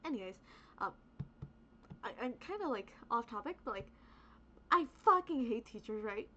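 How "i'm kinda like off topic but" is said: ordinary delivery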